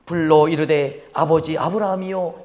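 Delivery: mock polite reading. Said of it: "이렇게 정중하게 아주 그냥 이렇게".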